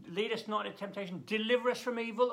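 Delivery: quick, rising prayer recitation; brisk liturgical quote; quoted prayer. Acted. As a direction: rattle off the familiar prayer, lifting at the end. Quoting the prayer.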